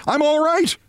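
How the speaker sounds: very high, insistent yelp; reassuring yell. A narrator's reading. Briggs insisting to mother, high-pitched yell. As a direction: push the pitch way up to reassure her. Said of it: "Briggs yelled to reassure her".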